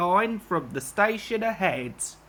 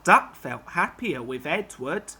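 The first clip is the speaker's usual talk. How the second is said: plain reading voice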